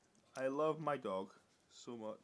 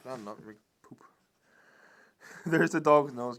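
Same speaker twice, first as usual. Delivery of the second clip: ordinary talk, nothing marked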